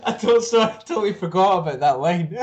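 high-pitched laughing through words. Real, not acted. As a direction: giggle while talking. Laughs at the joke.

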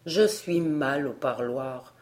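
reply humbly, drawn out and low; modestly. A narrator's reading. The tutor excusing himself to Barbara, modestly. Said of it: "répondit modestement le précepteur".

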